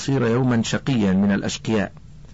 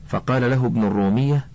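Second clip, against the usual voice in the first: ordinary delivery, nothing marked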